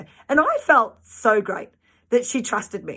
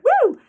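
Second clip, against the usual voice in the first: excited whoop; 5 dB louder than usual. Real, not acted. Cheering after urging marriage.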